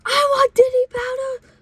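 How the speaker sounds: high, loud clamoring cry; eager kids yelling. Retold or imitated. Kids answering the powder offer, high eager yell. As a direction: shout it like a crowd of excited kids. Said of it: "like, eight kids go".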